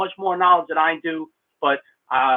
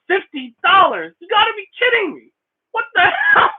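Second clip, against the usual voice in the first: climbing much higher into a laughing shout; stunned excitement. Real, not acted. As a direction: blown away by a big donation. Reacts to fifty dollar donation.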